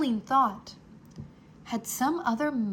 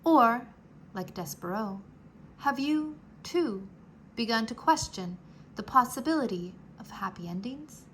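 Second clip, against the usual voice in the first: usual speaking voice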